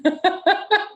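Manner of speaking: bright high laugh, caught off guard. Real, not acted. Laughs at question about husband.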